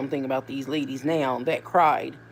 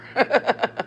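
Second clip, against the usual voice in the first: high, short burst of giggles; real chuckle; 5 semitones higher than usual. Real, not acted. Amused that cussing is everywhere, real chuckle. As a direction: laugh it off in a quick high run.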